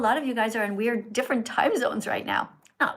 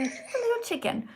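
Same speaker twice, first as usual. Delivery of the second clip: encouraging; 12 semitones higher, 4 dB quieter than usual. Real, not acted. Praising child's answer.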